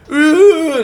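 long high-pitched "ooh" wail; imitated yell. Surfer imitates a stranger's yell heard while paddling out, imitated yell. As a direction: mimic a loud, distant distress yell. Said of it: "like, yell".

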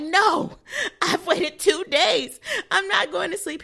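loud, high indignant protest; pissed off. Retold or imitated. Patient refusing to sleep after two days, acted outrage. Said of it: "I'm pissed".

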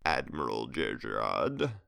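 gravelly, slow, smirking Emperor. A narrator's reading. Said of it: "the Emperor said with a gravelly voice and a smirk".

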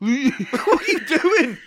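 high, slow laughing disbelief. Real, not acted. Host laughing at the endless impression, laughing. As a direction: laugh through the words.

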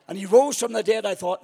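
ordinary talk

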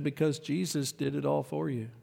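soft, gentle pastor voice; tender reassurance. Retold or imitated. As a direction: comfort the child quietly. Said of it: "He said".

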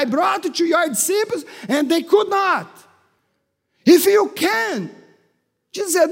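a hint of Jesus, preached loud; Jesus voiced by preacher. Preacher voicing Jesus to the man, forceful declaration. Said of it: "Jesus said to the man".

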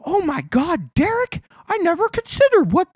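high, gushing mock voice; mock convert. Mocking an Instagram follower, high gushing voice. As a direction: play a follower suddenly won over. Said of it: "There's no one on your Instagram feed that's like".

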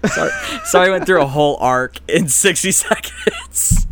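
high, loud, breaking into laughter; real laughing apology. Real, not acted. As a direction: apologise while cracking up at yourself. Cracks up after own rant.

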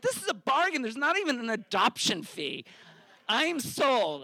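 high-pitched delight at a bargain; excited. Retold or imitated. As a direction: sound thrilled you're getting free stuff.

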